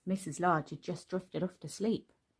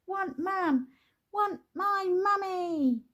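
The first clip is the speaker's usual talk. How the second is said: put-on much higher, slow toddler protest; bellowing toddler; 13 semitones higher, 4 dB louder, slower than usual. A narrator's reading. Baby refusing her dad, high slow bellow. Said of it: "bellowed the baby".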